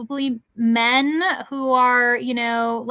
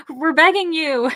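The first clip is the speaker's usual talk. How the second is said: playful pleading; 6 semitones higher, 6 dB louder than usual. Real, not acted. Jokingly pleading with viewers for graphics.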